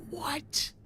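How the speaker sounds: a breathless, high whispered what. Retold or imitated. Shocked at hearing the salsa comes in a mason jar, whispered. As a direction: gasp it out in disbelief.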